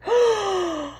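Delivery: sudden gasp of alarm; sharp high gasp. Acted. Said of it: "Goodness gracious, cried Hen".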